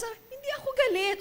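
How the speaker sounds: high, fast, prickly "I'm not angry"; defensive denial. Retold or imitated. Angry person denying anger, high fast defensive. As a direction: snap back, insisting you're not mad.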